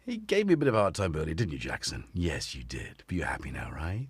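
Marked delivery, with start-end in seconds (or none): 1.3-4.1 soft, low cooing at a pet. Real, not acted. Owner softly cooing to his cat Jackson, baby talk. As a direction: coo gently to the cat.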